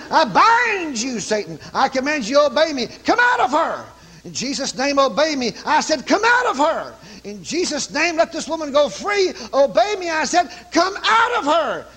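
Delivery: high, forceful preacher's command; commanding exorcism. Retold or imitated. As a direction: order the spirit out, pitch soaring. Acted exorcism, commanding the evil spirit.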